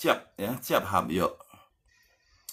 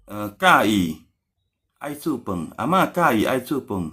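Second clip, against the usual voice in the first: ordinary delivery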